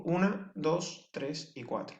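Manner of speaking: slow, soft count fading out; counting syllables softly. Shown as a demonstration. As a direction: count the four syllables slowly, trailing off. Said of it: "serían cuatro sílabas".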